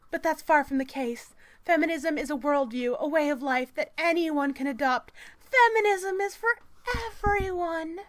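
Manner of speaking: high, loud proclamation, building to the end; strident article read. Acted. Reading the article's case that feminism is for everyone, strident.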